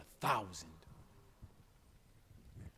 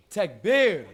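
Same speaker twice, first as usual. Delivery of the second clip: much louder, higher-pitched shout; rousing shout; 6 semitones higher, 15 dB louder than usual. Real, not acted. Preacher demanding a prouder takbir from young men, full-voiced shout. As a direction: belt it out high to rally the crowd. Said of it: "Say it like your father".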